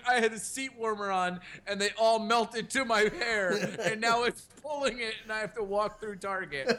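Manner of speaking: lightly voiced grievance, half laughing; exasperated complaint. Dad complaining to his daughter about melted marshmallows, mock exasperated.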